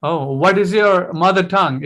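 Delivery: usual speaking voice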